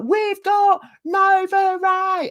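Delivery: very high, slow mock reveal; mock announcer. Mimicking a streamer introducing a guest, showy high announcement. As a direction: stretch it out like a big unveiling. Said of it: "and then she said".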